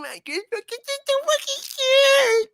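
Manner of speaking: very high, squeezed squeal; exaggerated mock-cute sarcasm; poured-on sarcasm. Shown as a demonstration. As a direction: coo it in a tiny strangled voice. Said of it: "I was trying to pour sarcasm into this".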